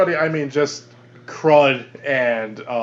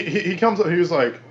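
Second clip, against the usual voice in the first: normal talking voice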